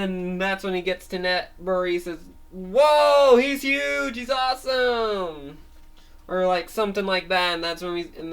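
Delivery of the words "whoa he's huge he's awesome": high, loud whoa easing down, slowing at the end; awed onlooker. Acted. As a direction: gape at the giant. Crowd in Netbury gapes.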